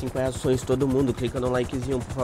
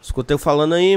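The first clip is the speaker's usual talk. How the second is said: raised voice over radio; calling out; 4 semitones higher, 6 dB louder than usual. Acted. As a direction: call out to be heard.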